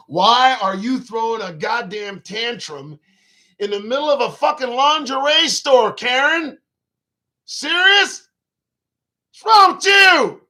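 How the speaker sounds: indignant telling-off building to a high shout; indignant scolding. Acted. Man scolding Karen for a tantrum in a store, building to a shout. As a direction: start loud and climb to an incredulous yell.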